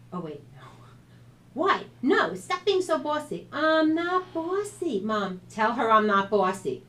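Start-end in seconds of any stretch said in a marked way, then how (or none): 1.5-3.5 loud and high, bossy accusation; sister's loud annoyed protest; annoyed high outburst. A narrator's reading. Sisters arguing, retake. 3.5-6.8 loud high, slow "I'm not bossy"; loud indignant whine to Mom; indignant denial. A narrator's reading. Sister protesting.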